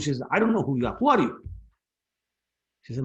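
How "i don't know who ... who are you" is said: mock-indignant mother, rising to a loud, high demand; angry retort. Mother challenging her boastful son, acted angry. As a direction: throw the question back in irritation. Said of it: "in very angry mood".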